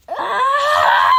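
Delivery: loud, high-pitched "Ah!" scream. Retold or imitated. Fan acting out En's reaction, high scream.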